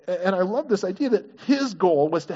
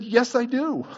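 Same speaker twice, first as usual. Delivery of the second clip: higher, insistent mock retort; arguing back; 6 semitones higher, slower than usual. Peter talking back to God, lightly acted insistence. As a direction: push back in a mild back-and-forth squabble. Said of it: "I was there arguing with God".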